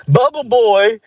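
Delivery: high, loud taunting shout; mocking yell. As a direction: yell it across the track like a kid. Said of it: "That's what people would just call me".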